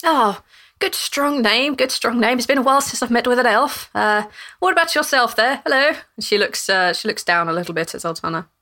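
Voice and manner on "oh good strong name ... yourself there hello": lightly voiced old lady. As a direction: savour the name like a fond granny. Cassandra greets the elf.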